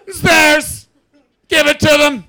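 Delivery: put-on high, very loud furious old Brit; shouting old Englishman. Old Englishman ordering the soldiers about, shouting high. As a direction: roar the orders like an outraged gent. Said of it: "And Zayas goes".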